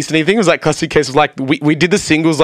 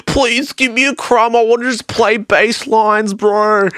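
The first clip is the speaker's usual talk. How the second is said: high, drawn-out pleading whine; mock begging; 9 semitones higher, slower than usual. Friends wanting into the band, mock begging. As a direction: plead it high and slow, hammed-up.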